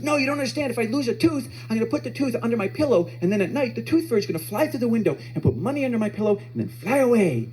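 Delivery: put-on high, eager little-kid explanation; earnest small child. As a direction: explain it like a believing seven-year-old. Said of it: "he starts explaining to me and his brother".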